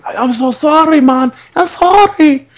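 high-pitched apologetic imitation; Manuel. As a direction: plead sorry in a comic high voice. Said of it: "sounded like Manuel in Fawlty Towers".

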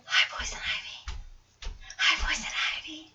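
put-on slow, hushed kid voice; whispery little girls. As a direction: imitate shy little girls greeting her softly. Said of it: "they were like".

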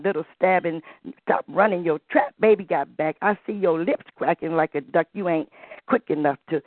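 ordinary talk

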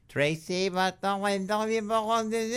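high, rising grandparent's plea; imitating the grandparent's pleading question, pitched high; grandparent speaking French. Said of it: "would speak to her in French".

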